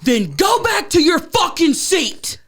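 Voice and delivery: high, slow, furious yell; acting out an angry public outburst; re-enacted screaming. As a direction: yell it like you've completely lost it. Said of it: "then I start screaming".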